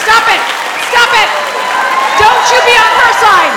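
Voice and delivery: loud, high mock-outraged yell; loud yell at the audience for siding with mom; real yell at the audience. Real, not acted. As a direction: scold the crowd for laughing. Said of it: "yelling at laughing audience".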